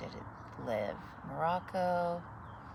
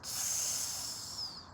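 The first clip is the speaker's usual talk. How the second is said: snake hiss. Acted. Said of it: "She hissed".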